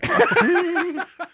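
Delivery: loud high burst of laughter; real laugh. Real, not acted. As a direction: cackle at the punchline. Laughs after punchline.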